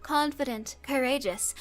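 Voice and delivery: ordinary talk, nothing marked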